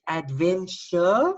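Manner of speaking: Adventure drawn out slowly as a test; slow sounding-out. Shown as a demonstration. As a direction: try Adventure slowly to hear it. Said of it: "adventure sounds like a word".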